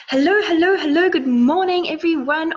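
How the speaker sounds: loud, high, bubbly hello to the viewers; excited greeting. Real, not acted. Host greeting viewers as the live stream begins, loud and excited. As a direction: greet the whole live audience with a burst of joy.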